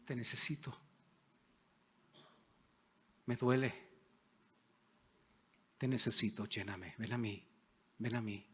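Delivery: tender whispered-close prayer, fading away; hushed prayer. Shown as a demonstration. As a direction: murmur it as an intimate plea. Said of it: "Y dile".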